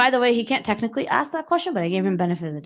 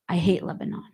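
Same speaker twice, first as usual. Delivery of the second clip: muttered aside; 2 semitones lower than usual. Retold or imitated. Interviewer voiced, hostile remark.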